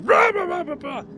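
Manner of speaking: monster roar. Retold or imitated. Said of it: "being like".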